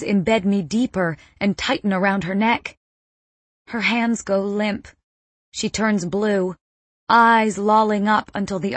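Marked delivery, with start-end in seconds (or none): none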